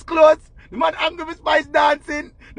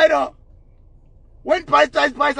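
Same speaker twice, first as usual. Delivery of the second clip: ordinary talk, nothing marked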